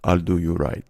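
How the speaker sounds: put-on low, confiding old-man promise; old deli man. Deli owner flattered by a customer's trust, low and confiding. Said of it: "and he just goes".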